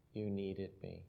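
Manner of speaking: put-on very quiet, tender toddler line; soft child's voice. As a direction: say it hushed, like a small child. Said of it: "And she goes".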